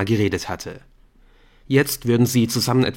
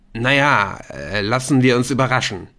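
louder, forced casual; 3 semitones higher, 4 dB louder than usual. A narrator's reading. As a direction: say it loud to cover up. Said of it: "sagte er laut".

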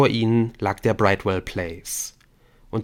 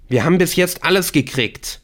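high, louder boast; defiant, raised-pitch comeback; defiant retort; 8 semitones higher, 5 dB louder than usual. Acted. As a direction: hit back at the doubter. Masked captor snaps back.

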